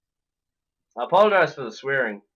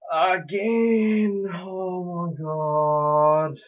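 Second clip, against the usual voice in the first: drawn-out despairing moan; exasperated wail; 3 semitones higher, much slower than usual. Real, not acted. Gamer who has died once more, wailing it slowly. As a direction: start high and drag the words out in dismay.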